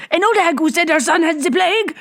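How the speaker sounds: very high, urgent gasping voice; urgent captain. A narrator's reading. Captain warns Bibot about the cart, urgent. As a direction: blurt it in alarm.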